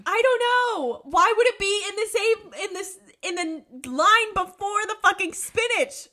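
high, loud, baffled rant; exasperated outburst. Real, not acted. Co-host baffled by the plot hole, exasperated shouting. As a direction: cry out in exasperation, building to a shout.

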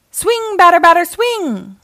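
loud, high ballpark chant; sing-out storybook call from the stands. A narrator's reading. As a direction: cheer on the batter. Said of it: "called Rosie".

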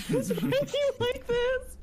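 mock crying. Retelling reaction to Kroof's video, mock tearful wail. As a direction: wail it like happy tears. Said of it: "And I was like".

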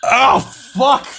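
a loud, high cry of pain as the blow lands; pained outburst. Acted. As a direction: yelp it out like you've just been cut. Character takes four damage.

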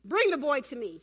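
loud, curt acted command; acted frustration, projected hard; frustrated Jesus. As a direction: order it loudly, fed up. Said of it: "He's frustrated at this point".

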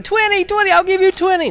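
very high, loud frantic bidding yell; excited yelling. Acted. Tim's mom bidding on her son, shrieking high and loud. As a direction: shriek the bid like an overeager mom. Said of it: "jumped up and down yelling".

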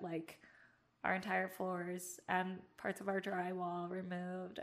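ordinary talk

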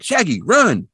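retold warning shout; urgent call. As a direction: hurry him out, imitating Scooby. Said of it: "he's like".

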